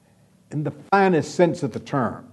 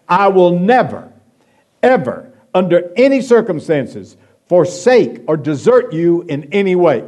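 "never" and "ever" pressed hard, louder; emphatic; 3 semitones higher, 6 dB louder than usual. Shown as a demonstration. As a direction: drive each negative home. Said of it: "It's a dogmatic, emphatic statement".

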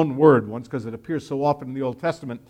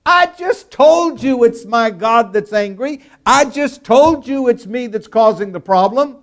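high, louder frustrated insistence; exasperated Jonah; 6 semitones higher, 4 dB louder than usual. Acted. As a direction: Jonah repeating himself, fed up. Preacher voices Jonah's exasperated repeat.

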